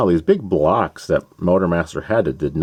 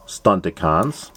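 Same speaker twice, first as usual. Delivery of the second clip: ordinary talk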